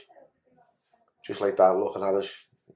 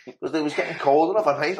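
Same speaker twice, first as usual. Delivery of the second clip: ordinary talk, nothing marked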